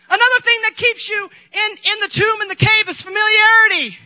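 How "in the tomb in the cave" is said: ordinary talk, nothing marked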